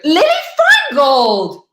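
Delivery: delighted cry of a friend's name; excited call. Real, not acted. Greeting a friend seen live, excited shout. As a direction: call out "Feingold" with joyful surprise.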